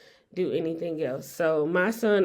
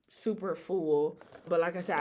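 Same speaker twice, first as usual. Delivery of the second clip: ordinary talk, nothing marked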